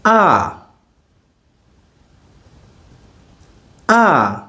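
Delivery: A falling sharply from high; A in the sharp falling fourth tone. Shown as a demonstration. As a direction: drop the A hard like a command. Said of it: "Fourth tone".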